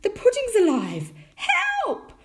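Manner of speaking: very high, loud alarmed shriek; panicked cry. A narrator's reading. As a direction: cry out for help in comic panic. Mother panics at living pudding.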